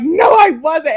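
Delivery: high, loud re-enacted protest; mock-outraged comeback; indignant denial. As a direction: contradict him emphatically. Acts out protest to husband.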